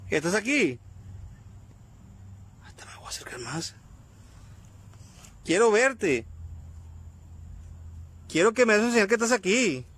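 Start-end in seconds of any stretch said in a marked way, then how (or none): 2.6-3.7 unvoiced hushed whisper. Real, not acted. Creeping closer to a spirit. 5.4-9.8 summoning call. Real, not acted. Summoning the spirit aloud.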